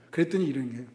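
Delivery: usual speaking voice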